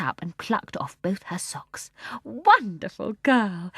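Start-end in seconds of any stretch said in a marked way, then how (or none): 2.2-3.7 proud. A narrator's reading. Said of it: "said Missus Banks proudly".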